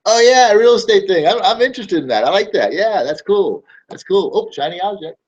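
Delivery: high, loud, gushing customer voice; mock customer. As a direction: play the easily excited, easily distracted buyer. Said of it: "customer reminded again".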